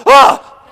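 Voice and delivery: very high, loud scream; startled puppy yelp. Retold or imitated. Puppies frightened by Whip, high mock shriek. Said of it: "And the puppies are like".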